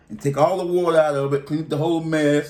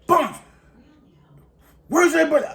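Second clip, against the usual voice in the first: high, sharp mock boss voice; boss calling out; 13 semitones higher, faster than usual. Supervisor asking the inmate what he's doing, in a high mock voice. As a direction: call across the kitchen like a puzzled supervisor. CEO yells at him again.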